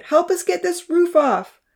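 put-on high, slow child's call. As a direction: urge the others on. Charlie calling for help.

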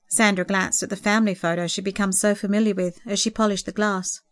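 ordinary delivery, nothing marked

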